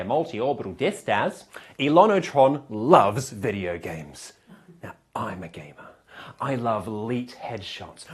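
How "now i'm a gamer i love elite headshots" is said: mock confession whisper. Confessing being a gamer.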